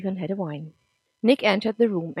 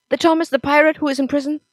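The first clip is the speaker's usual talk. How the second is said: high, loud and fast; shocked disbelief; 8 semitones higher, 9 dB louder, faster than usual. Acted. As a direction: blurt it out in alarm. Nick shocked at pirate father.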